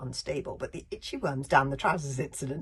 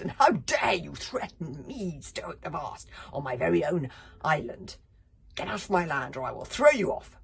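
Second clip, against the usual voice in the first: angry chief; 7 dB louder than usual. Acted. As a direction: bellow the threat. Chief threatens angrily.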